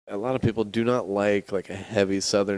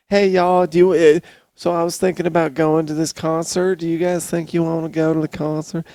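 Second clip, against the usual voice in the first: put-on high, loud sweet Southern girl; sing-song Southern invite, raised pitch; Southern girl accent; 7 semitones higher, 4 dB louder than usual. Imitating the Southern girl inviting friends, high accent. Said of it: "that accent is ridiculous".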